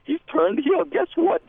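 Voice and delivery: ordinary delivery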